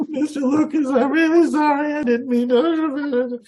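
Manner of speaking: high, loud, pleading sing-song apology, slowing at the end; groveling. Retold or imitated. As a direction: beg forgiveness like a desperate fan. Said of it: "You have never seen such groveling".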